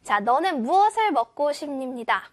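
ordinary talk, nothing marked

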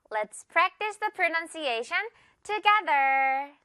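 slow, drawn-out show host voice; announcer. Acted. Teacher announcing the practice segment, host style.

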